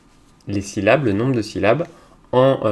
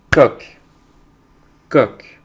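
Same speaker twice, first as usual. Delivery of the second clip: Coq as one loud, isolated beat; loud, short Coq set apart by silence; syllable beat; 3 semitones higher, 9 dB louder than usual. Shown as a demonstration. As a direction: punch Coq as a single syllable. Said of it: "on va frapper les syllabes".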